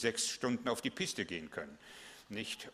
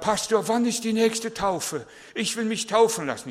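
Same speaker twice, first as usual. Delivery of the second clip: put-on eager young man; 10 semitones higher, 8 dB louder than usual.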